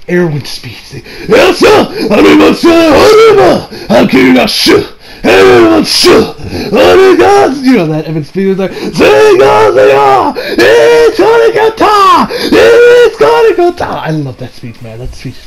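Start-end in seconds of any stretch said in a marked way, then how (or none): 1.4-7.6 high, loud hammed-up battle cry in fake Japanese; mock anime speech. Vlogger hamming up the commander's epic speech, loud and high. 8.7-13.9 high, loud, drawn-out mock war cry; hammed-up battle shout. Vlogger screaming the commander's charge speech, high and slow.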